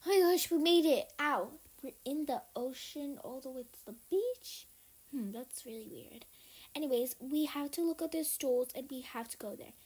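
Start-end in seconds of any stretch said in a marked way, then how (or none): 5.1-6.3 soft whisper. Pretend. Aside while playing.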